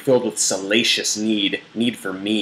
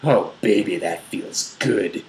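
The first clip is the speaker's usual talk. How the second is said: murmurs; slower than usual. A narrator's reading. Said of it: "he murmurs".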